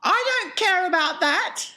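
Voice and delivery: put-on high, loud spoilt-girl shout. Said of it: "shouted Veruca".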